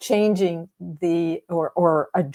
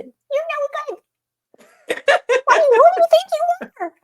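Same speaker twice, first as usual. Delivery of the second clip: squeaky gremlin voice; 5 dB louder than usual. Pretend. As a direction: make it a silly little gremlin mocking you. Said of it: "give it a little squeaky voice".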